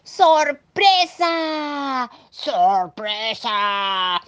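grito lento y alargado de sorpresa; grítalo estirando cada sílaba; gritando. Acted. (grito de sorpresa)

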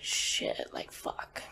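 breathy hushed curse under the breath; whispered curse. Retold or imitated. To herself as the doctor asks about the bruises, whispered curse. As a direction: whisper it like you just got caught.

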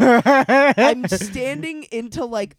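slow and loud through laughter; laughing at the memory of the mud. Real, not acted. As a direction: drag the words out while laughing. Laughing while retelling mud.

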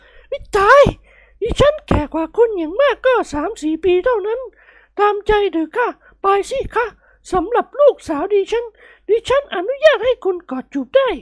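shrieky high falsetto, then indulgent; exclaim, then coyly give permission; flustered matron. Acted. Lady protesting being called mother, flustered then indulgent.

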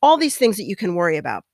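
usual speaking voice

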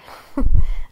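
a sudden loud burst of laughter. Real, not acted. Laughing out loud at forgetting mum is on Instagram. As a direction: laugh out loud at your own blunder.

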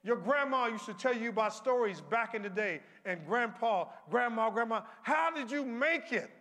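full-force gospel whoop; preaching at full force. Acted. Preaching climax.

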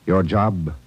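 usual speaking voice

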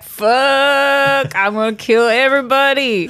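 hammed-up, high-pitched furious wail; mock rage. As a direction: rage like an overdramatic teenager, jokingly. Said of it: "And he's like".

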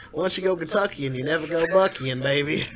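put-on Kentuckian accent. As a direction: play a boastful Kentucky good ol' boy. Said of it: "That's the Kentuckian".

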